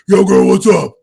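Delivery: very loud party shout; booming raspy yell. Acted. Yelling to a girl at a loud party, booming. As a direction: bellow a pickup line over loud music. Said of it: "My voice got lower".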